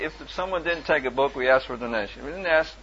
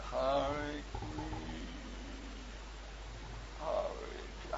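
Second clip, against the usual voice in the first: very slow, softer mangled "Hare Krishna"; halting attempt; 12 dB quieter, slower than usual. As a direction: mumble the words unsure. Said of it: "you almost got it".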